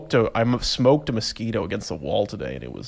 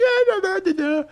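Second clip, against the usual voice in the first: mock splashing kids; 4 dB louder than usual. Imitating kids frolicking in water.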